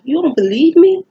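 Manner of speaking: high and loud, offended; mad disbelief. Retold or imitated. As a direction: demand it like she's insulted you. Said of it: "I was so mad".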